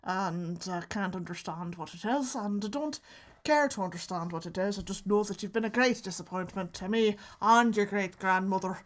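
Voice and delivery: mock Irish accent, monotone disappointment; Irish great-grandparent. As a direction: disown them in a tight-mouthed Irish accent. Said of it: "Irish is my great grandparents".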